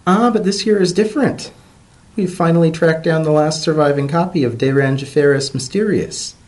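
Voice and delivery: high eager scholarly voice; professor Ivory. Acted. Professor explaining to Dodded, high and eager. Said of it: "Ivory said".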